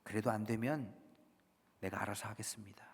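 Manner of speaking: dropping soft and low, an aside; quiet backtracking. Acted. Believer privately hedging a confession to God, quiet backtracking. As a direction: take it back under your breath.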